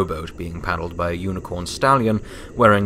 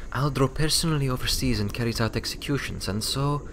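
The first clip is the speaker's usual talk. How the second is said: lightly voiced Materi; 7 semitones higher than usual. A narrator's reading. Materi continues explaining.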